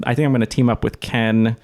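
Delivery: ordinary talk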